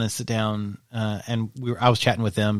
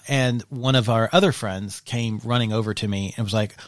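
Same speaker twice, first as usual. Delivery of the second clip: ordinary talk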